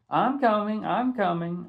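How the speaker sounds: hurry, call back over the honking. Acted. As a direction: voice lifted, calling I'm coming. Calling out to honking Coach.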